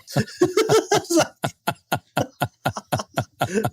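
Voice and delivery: long, high peal of laughter; rolling high laugh. Real, not acted. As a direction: laugh hard at your own story. Laughing after the police anecdote.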